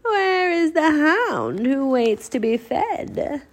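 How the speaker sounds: slow, high, eerie recital; reciting. A narrator's reading. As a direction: recite it like a mysterious riddle. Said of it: "And she recited more".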